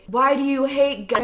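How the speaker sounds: retold shout. Protester confronts a couple at Pride Fest, imitated shout. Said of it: "put their megaphone next to my ear and shouted".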